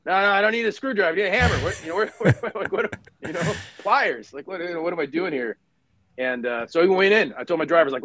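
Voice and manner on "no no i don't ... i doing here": higher, fast, half-laughing fluster; flustered scramble. Retold or imitated. Officer caught off guard in the ambush, higher and fast with a flustered laugh. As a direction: mime grabbing the wrong tool in a panic. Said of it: "you're like".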